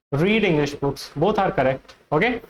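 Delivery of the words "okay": ordinary talk, nothing marked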